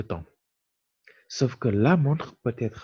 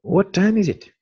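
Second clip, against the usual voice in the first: raised model question; 9 semitones higher, 5 dB louder than usual. Shown as a demonstration. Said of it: "on dira".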